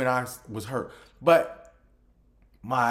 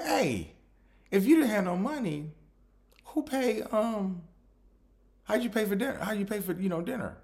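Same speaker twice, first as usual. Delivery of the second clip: mock confrontation; 10 semitones higher than usual.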